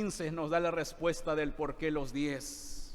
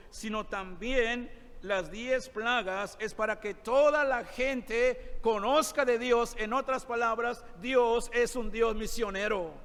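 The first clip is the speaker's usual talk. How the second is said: preaching at full force; 5 semitones higher than usual. Acted. Pastor pressing the point to his brothers, forceful preaching. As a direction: preach it with mounting force to the hall.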